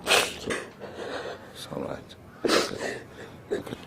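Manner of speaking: slow, whispered reassurance; tender whispered calming; soft comforting. Acted. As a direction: hush her gently, barely voiced. Comforting the crying servant.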